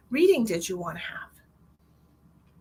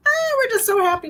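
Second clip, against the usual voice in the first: high, louder burst of happiness; gushing joy; 12 semitones higher, 7 dB louder than usual. Real, not acted. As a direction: let the joy spill over on so happy. Gushing about family visit.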